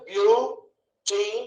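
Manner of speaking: బ్యూటిఫుల్ slowly split as "బ్యూ... టి... ఫుల్"; long pauses between బ్యూ, టి and ఫుల్; three syllables. Shown as a demonstration. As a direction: break బ్యూటిఫుల్ into three slow beats. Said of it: "మూడు వచ్చినాయి కాబట్టి".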